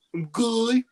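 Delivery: very high comic character impression; Mister Goodman. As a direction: swing it up high in a put-on character voice. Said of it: "Mister Mackey and Mister Goodman".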